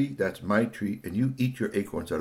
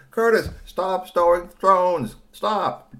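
slow, loud, high scolding shout; calling out sternly; 10 semitones higher, 7 dB louder, slower than usual. Acted. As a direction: shout at a child to stop, word by word. Calling up to stone-throwing Curtis.

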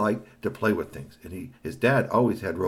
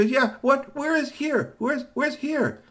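put-on high boy voice; 3 dB louder, slower than usual. A narrator's reading.

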